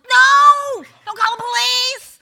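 high, loud panicked cry; frantic shout. Retold or imitated. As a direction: yell it in a panic at the husband. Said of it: "I'm like".

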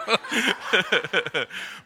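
real run of chuckles; laughing. Real, not acted. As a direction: chuckle helplessly at the reaction. Laughing at audience reaction.

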